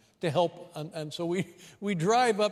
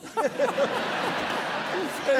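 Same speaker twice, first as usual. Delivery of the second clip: loud high laugh, cracking up; high burst of laughter; 4 dB louder than usual. Real, not acted. Host cracks up at his own joke, high laugh.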